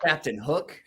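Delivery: ordinary talk, nothing marked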